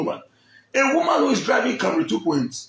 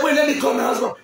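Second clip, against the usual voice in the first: retold threat shout; 7 semitones higher than usual. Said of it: "She said".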